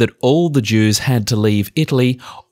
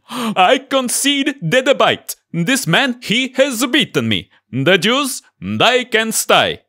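high, slow papal voice; lightly voiced old Pope; 10 semitones higher, slower than usual. Pope voiced conceding debate.

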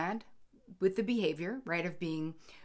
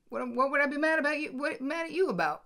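higher and fast, stumbling over the words; flustered disbelief; 9 semitones higher, 5 dB louder than usual. Retold or imitated. Husband caught off guard, a flustered higher denial. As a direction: sputter the denial in a rush.

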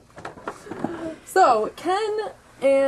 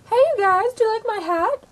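very high, louder character voice; 12 semitones higher, 4 dB louder than usual. Acted. Asking about her hat, in a high female character voice. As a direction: pitch the question way up. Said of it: "asked Britney Spears".

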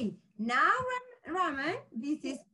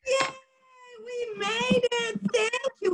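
excited; 8 semitones higher, faster than usual. Real, not acted. Celebrating with children.

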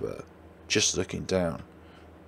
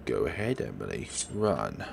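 much lower, soft, menacing; sinister taunt; 9 semitones lower, 5 dB quieter than usual. Acted. Killer taunting the girl to run, sinister. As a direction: savor the threat.

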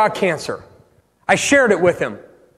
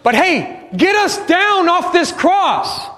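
higher, drawn-out mocking demand; 8 semitones higher, slower than usual. Thief on the cross jeering at Jesus, mocking. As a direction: jeer it slowly, pitched up. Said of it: "They're both kinda mocking him".

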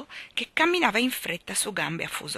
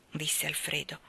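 plain reading voice

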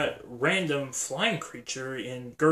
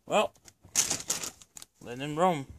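ordinary talk

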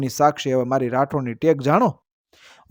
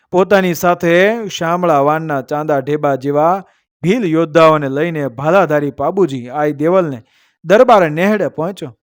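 ordinary delivery, nothing marked

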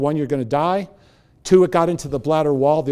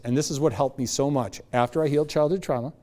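normal talking voice

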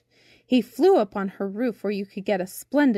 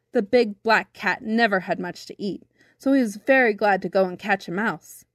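ordinary delivery

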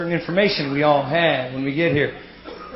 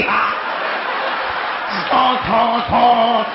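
silly clown honks; 4 semitones higher, much slower than usual. Acted. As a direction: honk like a clown horn. Mocking cop with honks.

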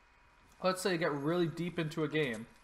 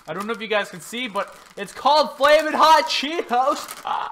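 pained shout; 8 semitones higher, 12 dB louder than usual. Real, not acted. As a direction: yell it out while your mouth burns. Burning mouth, no water.

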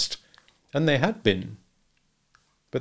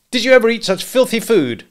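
high, loud, disgusted outburst; disgusted complaint; 13 semitones higher, 8 dB louder than usual. A narrator's reading. Husband complaining at breakfast table, disgusted. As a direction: rail at the food.

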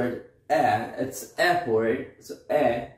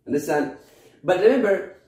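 ordinary talk

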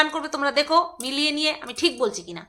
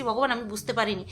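quiet prayer; 6 semitones lower, 9 dB quieter, faster than usual. Retold or imitated. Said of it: "ভগবানের কাছে ক্ষমা চেয়েছি".

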